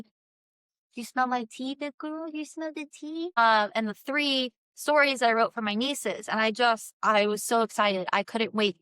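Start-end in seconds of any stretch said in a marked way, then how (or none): 0.0-3.3 pet talk. Real, not acted. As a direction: coo at the dog. Talking to her dog.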